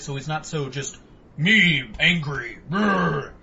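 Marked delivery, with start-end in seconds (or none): 1.4-3.3 slow, loud caveman grunt; dumb brute voice. Retold or imitated. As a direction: play a dim, angry brute. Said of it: "changed Bane a little bit".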